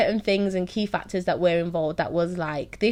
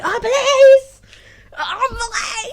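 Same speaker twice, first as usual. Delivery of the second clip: high, loud retold cry of alarm; shrieking in mock panic; mock panicked shout; 11 dB louder, much slower than usual. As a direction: yell it out like the police just showed up. Retold alarm on seeing police.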